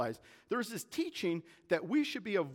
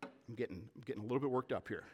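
quieter, lower chuckling aside; sheepish amusement; 6 semitones lower, 6 dB quieter, much faster than usual. Real, not acted. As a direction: own up to it with a small laugh. Sheepish aside after his own rant.

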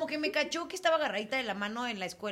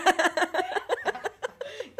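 high, loud burst of laughter; cackling laugh, higher and louder; big laugh; 10 semitones higher, 7 dB louder than usual. Real, not acted. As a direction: crack up at the friend's joke.

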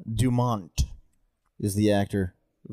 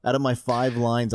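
ordinary talk

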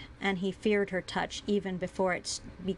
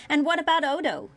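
louder, higher accusing outburst; heated, pitched-up challenge; raised voice; 6 semitones higher, 5 dB louder than usual. A narrator's reading. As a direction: let the voice rise in accusation. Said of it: "She let her voice raise".

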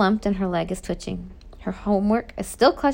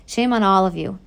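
usual speaking voice

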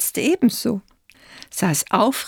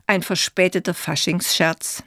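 ordinary delivery

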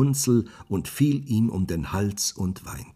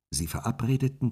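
usual speaking voice

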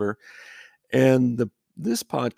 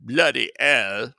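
mock British accent; 4 semitones higher, 7 dB louder, slower than usual. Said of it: "slips into a British accent".

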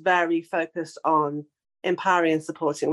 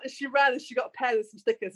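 ordinary talk, nothing marked

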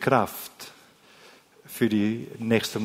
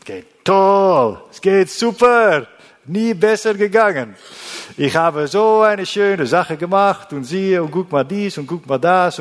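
boastful man; 6 semitones higher, 7 dB louder than usual. Retold or imitated. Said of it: "Wenn wir einander fragen".

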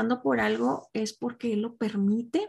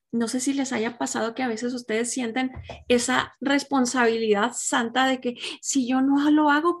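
ordinary talk, nothing marked

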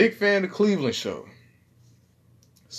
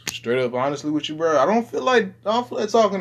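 higher and louder, street swagger, fast; 6 semitones higher, 6 dB louder, faster than usual. Shown as a demonstration.

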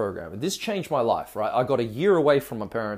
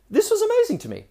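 thrilled. Real, not acted. Finding joy in the dance class.

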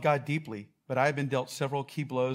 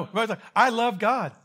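high loud "love" stressed; mock earnest crowd; 8 semitones higher, 8 dB louder, faster than usual. Pastor voicing his listeners, high loud earnest. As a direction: voice the crowd with big conviction.

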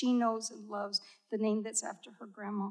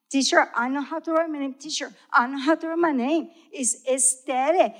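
put-on insistent child; 4 semitones higher, 9 dB louder than usual.

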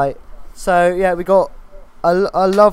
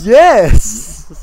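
Toast of London voice; 4 semitones higher, 10 dB louder than usual. Retold or imitated. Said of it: "Toast of London".

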